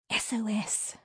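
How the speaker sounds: urgent hissed whisper. A narrator's reading. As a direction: hiss it under your breath. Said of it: "I hissed".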